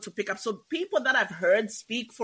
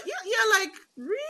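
incredulous; 12 semitones higher than usual. Retold or imitated. Retold disbelief at movie accents.

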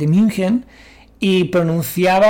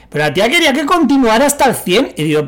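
ordinary talk

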